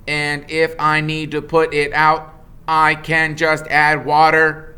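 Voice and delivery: a calm robot stating a fact. Acted. The robot Roz states it plainly, a level machine voice.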